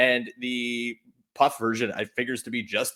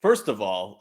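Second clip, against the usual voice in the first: normal talking voice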